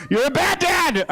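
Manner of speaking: high, drawn-out mock yell; mock accusation. Comic acting out an accusation at a father, high mock yell. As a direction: cry it out slowly like an accuser.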